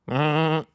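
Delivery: high loud bleating goat. Shown as a demonstration. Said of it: "do you wanna hear my goat impression?".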